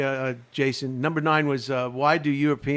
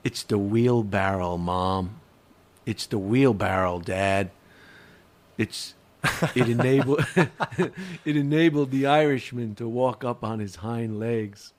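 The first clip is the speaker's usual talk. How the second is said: bad acting; 6 semitones lower, slower than usual. Shown as a demonstration. Punchline of the told joke.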